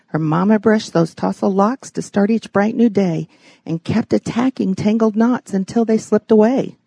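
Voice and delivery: usual speaking voice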